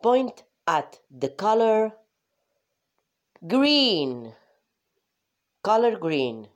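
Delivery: slow clear instruction. Shown as a demonstration. Teacher giving young learners a slow, loud instruction. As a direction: say it slowly and loudly so children can follow. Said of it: "Señala el color que te digo".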